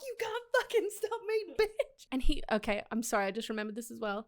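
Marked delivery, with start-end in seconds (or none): 0.1-2.1 high, giggly mock taunt; playful defiance pitched way up; laughing defiance. Acts out her defiance, laughing.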